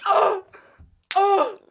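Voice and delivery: high squealing cry of fake pain; mock pained shriek. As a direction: yelp as if being hurt. Mock pain while grabbed.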